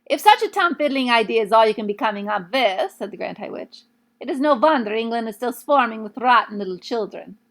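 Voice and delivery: commanding witch voice with a foreign lilt; Grand High Witch. Acted. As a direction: sneer it out as the head witch.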